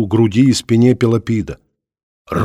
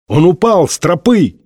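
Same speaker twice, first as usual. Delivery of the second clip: higher-pitched call from below; raised, urgent call; 7 semitones higher, 4 dB louder than usual. A narrator's reading. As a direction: shout the bad news up to friends. Calling up from below cliff.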